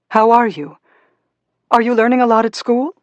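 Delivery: put-on bright lift, high-pitched mother to child; eager motherly questions. A narrator's reading. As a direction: ask your little one with keen interest. Mother asks young daughter about school.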